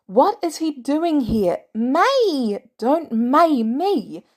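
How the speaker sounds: loud, slow angry scolding. Acted. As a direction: scold him furiously. May furious at Peter.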